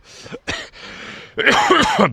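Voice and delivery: harsh throat-clearing cough; dry cough. Real, not acted. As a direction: hack out a frog in the throat. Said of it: "j'ai un chat dans la gorge là".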